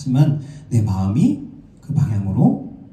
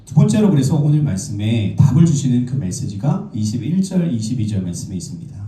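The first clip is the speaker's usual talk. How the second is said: ordinary delivery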